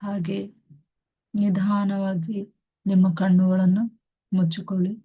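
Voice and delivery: very slow, soothing meditation guidance; guided meditation. Acted. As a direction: ease them into stillness, word by word. Guides meditation with long pauses.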